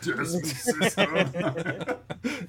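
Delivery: high, slow echo through laughter; laughing quote echo. Acted. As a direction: draw out the quote while cracking up. Echoes the movie quote, laughing.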